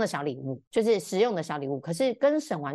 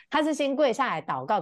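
ordinary talk, nothing marked

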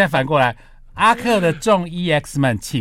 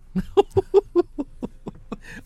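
high burst of laughter. Real, not acted. Laughs at own vote.

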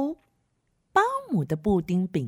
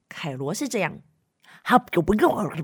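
ordinary delivery

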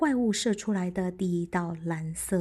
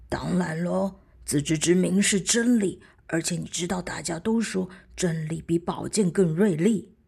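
mountain voice; 3 semitones lower, 4 dB louder than usual. Acted. Mountain answers.

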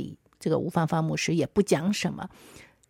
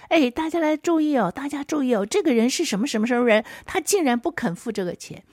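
higher-pitched, quick mock public announcement; calling out; 7 semitones higher, faster than usual. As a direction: call everyone's attention to someone, pitched up. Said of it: "他还在面店里面就敲桌子说".

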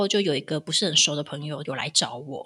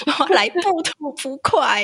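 mock grand vow; 8 semitones higher, 4 dB louder, slower than usual. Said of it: "mock-solemn declaration".

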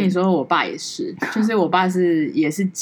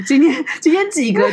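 high, loud eager question; mock eager asking; 10 semitones higher, 5 dB louder than usual. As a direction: imitate everyone checking the count. (模仿大家急着问今天几个)

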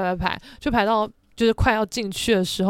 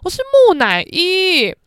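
much louder, insistent correction; loud, proud insistence; emphatic correction; 7 semitones higher, 10 dB louder than usual. Retold or imitated. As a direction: set the record straight firmly. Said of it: "我说".

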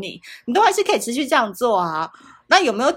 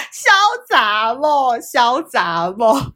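slow, loud, laughing; loud slow laughing quip; laughing drawl; 5 semitones higher, 8 dB louder, much slower than usual. Real, not acted. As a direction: drag the words out while laughing.

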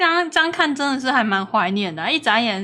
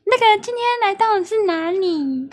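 put-on girly voice; 7 semitones higher than usual. Shown as a demonstration. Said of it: "你脑中的gay掰声音是什么".

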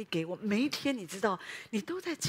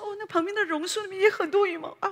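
mock surprise; 9 semitones higher, 6 dB louder than usual. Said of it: "牧师说".

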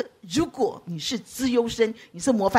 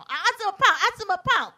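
mocking taunt; 7 semitones higher than usual. Mocking a fat person.

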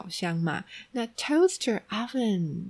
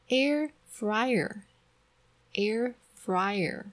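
slow model pronunciation; 3 semitones higher, much slower than usual. Shown as a demonstration. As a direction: Air fryer, air fryer said very slowly. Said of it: "teacher models word slowly".

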